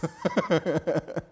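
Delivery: a brief chuckle at one's own remark; amused little laugh. Real, not acted. Laughs after joking about originals.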